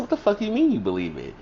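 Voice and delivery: replaying her own indignant comeback; retold outrage. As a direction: fire it back, indignant and fast. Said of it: "I said".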